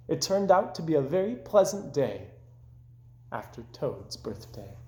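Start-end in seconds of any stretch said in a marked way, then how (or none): none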